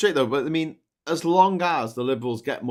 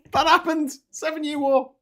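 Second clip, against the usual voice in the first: much higher and louder, playful insistence; emphatic; 6 dB louder than usual. Real, not acted. Insisting to a friend that it happened, emphatic. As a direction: declare it as settled fact.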